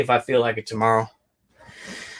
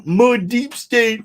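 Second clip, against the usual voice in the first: mock voice; 9 semitones higher, much slower than usual. Mocking internet phrase.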